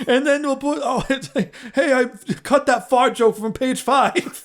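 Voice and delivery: high, slow goofy voice with laughs; mimicking Evan Goldberg. Roast bit: Goldberg pitching to Seth, high and slow. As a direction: drag out the dumb script note up high. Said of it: "mimicking what Evan Goldberg must be like".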